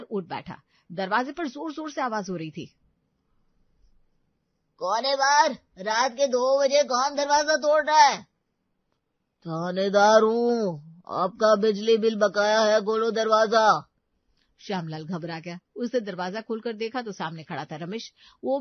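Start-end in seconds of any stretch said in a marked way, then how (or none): 4.8-8.2 loud calling through the door; angry shout. Acted. Neighbour shouting through the door at night, loud. 9.5-13.9 slow, loud officious command; mock officer. Sleepwalker posing as police at the door, slow officious order.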